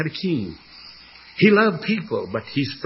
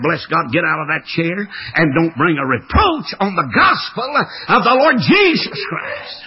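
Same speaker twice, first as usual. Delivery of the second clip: rising to a loud, high, slow preacher's cry; preaching at him; 3 semitones higher, 5 dB louder than usual. Retold or imitated. Father warning his ordained son, loud preaching climax. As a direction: thunder it at the end like a pulpit warning.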